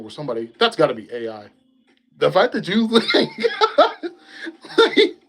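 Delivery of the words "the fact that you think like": high laughing speech; words broken by laughter. Real, not acted. Laughing at own idea.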